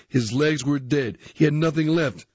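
ordinary delivery, nothing marked

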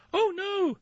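very high, alarmed squeak; tiniest billy goat. A narrator's reading. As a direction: plead in a tiny goat voice. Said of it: "said the tiniest billy goat Gruff".

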